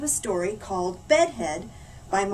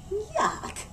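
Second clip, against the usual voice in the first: disgusted; 9 semitones higher, 5 dB quieter than usual. A narrator's reading. Disgust at bigger fur ball.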